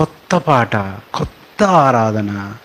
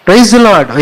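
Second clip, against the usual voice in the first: loud, high praise the Lord; enthusiastic greeting; 9 semitones higher, 5 dB louder, much slower than usual. Retold or imitated. Believers greeting each other, loud and high. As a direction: call it out like you're greeting a brother. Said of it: "ఎవరు కనపడినా ఏమంటాం".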